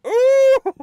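high, loud imitation of a wowed eater; mock excited ooh. Mimicking friends who loved the onion, mock excitement. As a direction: squeal it in mock amazement. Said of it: "but I didn't think like".

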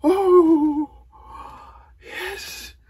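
high, loud whoops of victory; triumphant cheering. Real, not acted. Gamer celebrating the win alone, triumphant cheering. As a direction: cry out in real elation.

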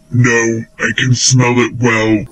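very low monster growl; a giant troll rumbling; deep troll voice. Acted. Troll answers.

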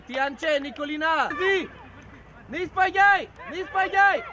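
loud coach's yell; calling out loudly to players; shouted instructions. Real, not acted. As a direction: shout short commands across the field.

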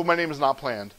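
low, soft deadpan confession; mumble-soft comic admission, pitched low; mock-sheepish introduction. Imagined embarrassed pupil introducing himself.

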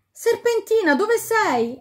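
very high, loud anguished call; frantic calling. A narrator's reading. The king shouts for Serpentina near the fire. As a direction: cry out for her in panic.

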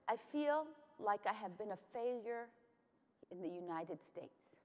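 soft, subdued retelling of grandma's sorrow; retold tearful confession. Grandma confesses to her granddaughter, retold softly and sadly. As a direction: quietly voice a crying grandmother, falling away. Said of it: "And she was crying".

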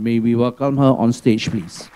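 ordinary delivery

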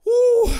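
loud high whoop; excited whoop. Real, not acted. Reacting to spicy chip.

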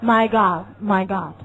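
slow "My God" with a wide-open ah; American my God. Shown as a demonstration. Teacher demonstrating the American exclamation. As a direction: stretch "My God" with the jaw wide. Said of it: "而美国人会说什么".

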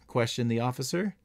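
ordinary delivery, nothing marked

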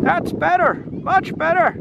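high, delighted character voice; crying out. Acted. Dragon after blowing his nose, crying out. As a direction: exclaim relief in a high storybook voice. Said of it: "he cried".

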